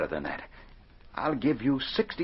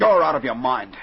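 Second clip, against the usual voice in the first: louder, an outraged brush-off; loud scoff; 3 semitones higher, 9 dB louder than usual. Acted. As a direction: throw his demand back in his face. Rejecting the demand for fifty thousand.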